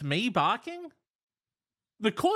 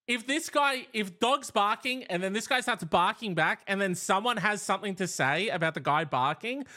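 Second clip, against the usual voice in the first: usual speaking voice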